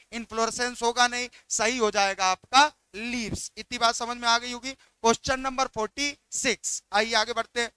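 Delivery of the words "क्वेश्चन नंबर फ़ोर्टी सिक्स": ordinary delivery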